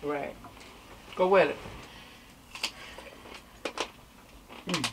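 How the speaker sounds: talking between bites; words muffled by food; mouth full, chewing. Real, not acted. Seen 2: Lips move as in chewing while she dips a cucumber slice.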